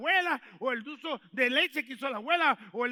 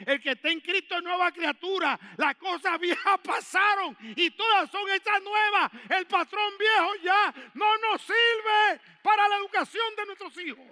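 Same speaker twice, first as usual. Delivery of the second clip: loud, high preaching shout; fervent preaching; 8 semitones higher, 10 dB louder than usual. Acted. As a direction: preach it out to the congregation. Preaching at full force. (predicación a gritos)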